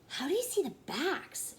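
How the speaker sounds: put-on high curious little girl; little sister voice. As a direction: ask it like a curious small child. Little sister asks dentist.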